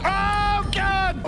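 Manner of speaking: high-pitched outcry; dismayed cry. Real, not acted. Gamer chasing an achievement in a fight, dismayed cry.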